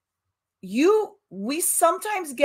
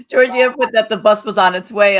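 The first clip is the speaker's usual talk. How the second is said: laughing through the words; giggling as she reads out the name; 2 semitones higher, 10 dB louder than usual. Real, not acted. Cohost laughing while thanking Georgianne, laughing.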